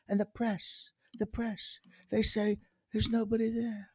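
imitated presidential stage whisper; soft, secretive impression of a politician; Trump. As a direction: drop to a hushed confiding whisper. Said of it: "he does this whisper".

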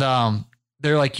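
normal talking voice

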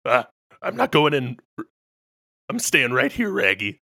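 lightly voiced cartoon dog; Scooby-Doo. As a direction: refuse with a hint of talking dog. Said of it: "tell Scooby to go in first".

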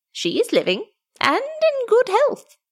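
dwarf messenger, solemn. A narrator's reading.

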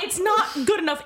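high, emphatic retort to mom; exasperated scolding. Retold or imitated. Acted scolding of mom in anecdote.

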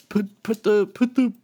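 high-pitched put-on voice; halting start in a high imitation voice; imitating a co-counselor. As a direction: do him high-pitched, stumbling into it. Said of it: "he talked like this".

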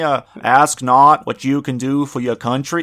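put-on slow, loud Boston-accented JFK speech. Friend at a podcast mocks JFK's slow, loud speech. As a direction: deliver it like a presidential address, slow and loud. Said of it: "the best J F K voice".

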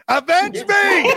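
high, slow, hammed-up dying cry; overacted death scene. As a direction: scream it like a dramatic death. The mother dragged away.

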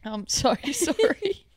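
laughing through the apology; breaking into laughter mid-sentence. Real, not acted. Apologising after coughing fit.

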